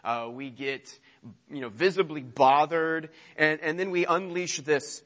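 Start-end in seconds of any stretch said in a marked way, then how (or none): none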